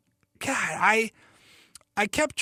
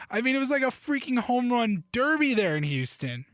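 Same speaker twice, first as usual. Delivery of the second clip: ordinary talk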